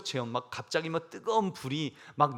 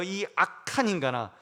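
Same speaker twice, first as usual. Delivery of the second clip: stern angry king; 4 semitones higher, 6 dB louder than usual. Retold or imitated. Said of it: "하나님이 뭐라고 그러실까요?".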